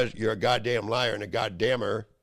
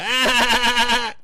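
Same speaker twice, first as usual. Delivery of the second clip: high, loud put-on "Ah!" mocking them; mocking cry; 12 semitones higher, 9 dB louder than usual. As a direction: mimic a taunting shriek in his face. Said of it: "laugh in my face, go".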